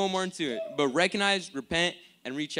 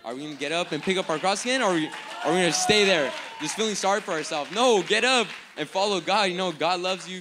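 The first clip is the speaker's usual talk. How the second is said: loud, higher rallying exhortation; impassioned preaching; 5 semitones higher, 8 dB louder than usual. Real, not acted. Preacher rallying the congregation to get up, impassioned preaching. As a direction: urge them to get up, building to a shout.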